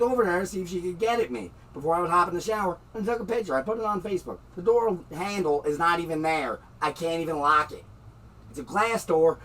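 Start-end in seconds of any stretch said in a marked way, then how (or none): none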